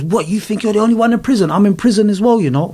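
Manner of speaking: a hint of an East End mum telling off her son; mum's retort. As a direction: play the hurt, sharp mother. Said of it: "she goes".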